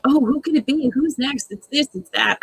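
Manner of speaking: a high, breathy mock of the housemates' gossip; mock excited gossip. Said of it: "they're like".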